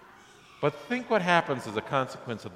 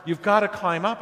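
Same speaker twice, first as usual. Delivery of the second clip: raised, higher retold yell; 6 semitones higher, 4 dB louder than usual. A narrator's reading. Man on bridge yells down to hanging man, mild mock yell. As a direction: urge him to climb, a bit louder and higher. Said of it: "So he yells at the guy".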